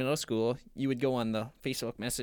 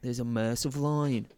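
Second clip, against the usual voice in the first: mock Aussie wildlife host; put-on Australian voice; Australian accent; 3 dB louder than usual. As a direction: narrate the danger as an Australian adventurer. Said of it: "I wanna hear your Australian accent".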